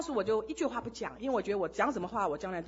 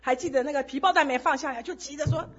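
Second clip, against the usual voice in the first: usual speaking voice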